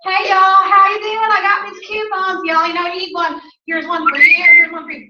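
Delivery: breeze in like a chatty Southern gal; bubbly Southern friend. Retold or imitated. Mimicking Nikki handing out coupons, bubbly Southern chatter. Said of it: "She will walk in and go".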